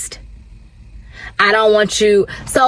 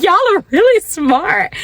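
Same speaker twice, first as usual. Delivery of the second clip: high-pitched, laughing amazement; astonished, tickled squeal of a line; amazed; 9 semitones higher than usual. Retold or imitated. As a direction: marvel at them, voice jumping way up. Laughing repeat of her college reaction.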